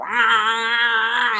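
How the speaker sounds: cat noise. Retold or imitated. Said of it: "that's just what she sounds like".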